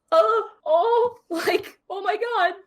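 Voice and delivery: high, stunned little cries; stunned gasp. Acted. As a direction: gasp like a noble seeing a slaughter. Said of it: "he's just like".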